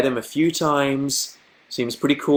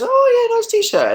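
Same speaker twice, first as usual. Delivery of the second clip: chorus squeaked up much higher and louder; high mock chorus; 5 dB louder, slower than usual. Friends chime in on the shirt, high mock chorus. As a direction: everyone pipes up in a high mocking chorus. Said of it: "everyone goes".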